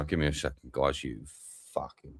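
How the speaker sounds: ordinary delivery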